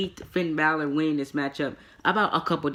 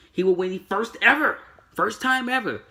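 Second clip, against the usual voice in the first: mock hype; 7 semitones higher, 5 dB louder than usual. Real, not acted. Mocking the promotion's constant hype.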